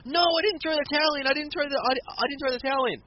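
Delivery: high panicked denial; panicked protest. Retold or imitated. Manager protests to the referee, high and panicked. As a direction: plead frantically, high-pitched. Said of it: "Ricardo dives in the ring like".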